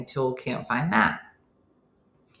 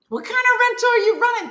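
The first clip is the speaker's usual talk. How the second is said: very high, squawking indignation; outraged, comically high-pitched complaint; indignant outburst; 5 dB louder, faster than usual. Retold or imitated. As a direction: rant in a far higher register. Acted breakdown, scolding the rental owner.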